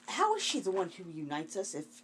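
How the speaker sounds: ordinary talk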